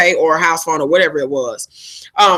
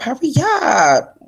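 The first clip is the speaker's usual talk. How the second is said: higher, slow stretched-out hurry up; drawn-out hurry up; 6 semitones higher, much slower than usual. Real, not acted. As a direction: drag the words out high and slow. Urging a friend to hurry.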